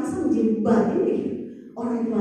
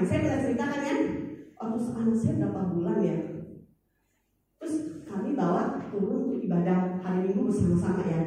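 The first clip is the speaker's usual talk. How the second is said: usual speaking voice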